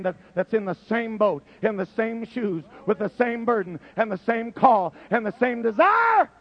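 rising to a preaching climax; building preaching. Acted.

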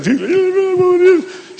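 high-pitched burst of tongues babble; imitated speaking in tongues. Woman crossing the grass to the trailer, imitated tongues. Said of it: "she's speaking in tongues".

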